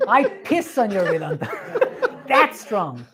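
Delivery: speaking through real laughter; amused, laughing. Real, not acted. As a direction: crack up while delivering the shocking quote. Amused by the crude original.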